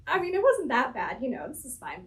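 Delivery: high, bright rising deflection, voice lifted way up; gushing Southern American. Retold or imitated. Mimicking Southern Americans dodging their feelings, high and chipper. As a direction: play down the hurt with a high chipper lilt. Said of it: "Americans are like".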